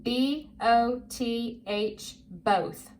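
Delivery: slow, letter by letter, then the word; measured spelling drill, each letter apart. Shown as a demonstration. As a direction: spell it slowly for a young reader. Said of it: "I'm gonna spell it and say it".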